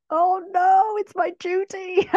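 high, loud comic wail; mock dismay. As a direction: cry out in exaggerated dread of the job. Retold dismay at being given duty.

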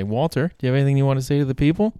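loud, fast and high; excited and loud. Real, not acted. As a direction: hype it up for the cat. Interviewing the cat.

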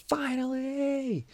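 very high, stretched-out mock scream; screamed relief. Inmate finally called to leave the hole, high screamed relief. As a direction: draw it out slowly in a high cry of release. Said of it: "I actually screamed".

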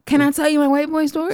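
high, loud, quick protest; exasperated plea to be allowed to talk. Real, not acted. Storyteller to interrupting co-host, real exasperation.

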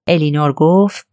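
plain reading voice

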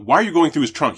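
loud, indignant question to the policeman; indignant demand. Retold or imitated. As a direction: push back hard on the officer. Lawyer challenges cop in retelling.

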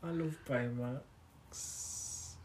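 very slow, hushed gushing; soft adoration. Real, not acted. Friend confessing love for favourite character, softly adoring.

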